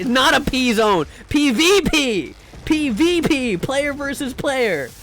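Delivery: loud, high-pitched, drawn-out shout; shouted, emphatic insistence; exasperated correction. Real, not acted. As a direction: spell it out loudly for chat. Mock-scolding chat over misread word.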